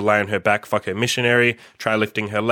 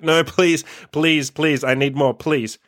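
higher-pitched imitated pleading; mock begging; 5 semitones higher, slower than usual. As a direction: beg in a put-on higher voice. Imitates partner begging for more.

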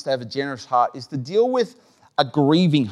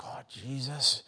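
hushed groan; 3 semitones lower, 15 dB quieter than usual. Retold or imitated. And I'm like.

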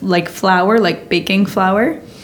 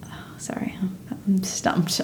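whispered aside; whispering; 14 dB quieter than usual. Real, not acted. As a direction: whisper it to yourself. Stuck thinking during game.

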